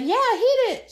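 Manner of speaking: high-pitched gleeful holler; excited egging on. Retold or imitated. Back-seat passenger urging driver over the bump, high excited yell.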